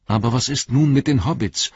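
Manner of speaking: high, urgent question; interrupting. A narrator's reading. Legolas interrupts Gandalf about the Hobbits, urgently. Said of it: "unterbrach ihn Legolas".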